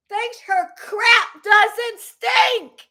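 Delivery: drawn-out furious yell; a slow shouted insult at the troll; slow angry shout. Real, not acted. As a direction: spit each word out slowly at her. Angry rant at an online troll.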